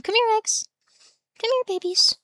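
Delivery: high, sing-song call to a pet; calling the cat. Real, not acted. Streamer calling her cat Eggs over, high coaxing.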